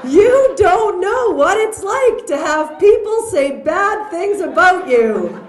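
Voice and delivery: high, loud and laughing through it; a hammed-up teen whine dissolving into laughter; laughing mock son. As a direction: repeat the kid's complaint while cracking up, slowly. Son's protest repeated, laughing.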